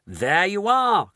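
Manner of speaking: slow, loud triumphant cry. A narrator's reading. Mr Wonka watching the canid retreat, triumphant cry. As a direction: crow over the victory. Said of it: "cried Mister Wonka, triumphant".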